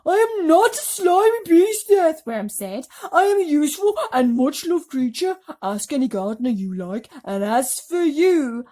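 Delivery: huffy high worm voice; indignant earthworm. Acted. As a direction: worm defending itself.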